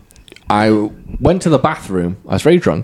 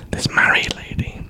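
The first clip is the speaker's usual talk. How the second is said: whispering; 6 dB quieter than usual. Retold or imitated. Whispered aside in quote.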